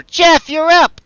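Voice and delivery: high, loud call to an ally; full-voiced, high-pitched summons; shouting over. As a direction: yell across to a teammate. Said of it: "Cam shouts over".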